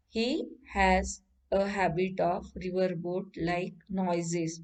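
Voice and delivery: usual speaking voice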